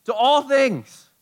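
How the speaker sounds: high, slow and loud; emphatic. Real, not acted. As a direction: lean hard on ALL. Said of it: "emphatic repeat of superior".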